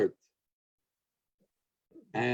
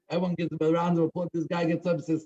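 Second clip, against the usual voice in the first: usual speaking voice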